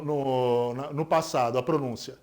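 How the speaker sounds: ordinary talk, nothing marked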